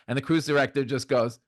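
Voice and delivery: normal talking voice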